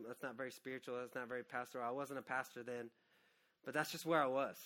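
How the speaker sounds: normal talking voice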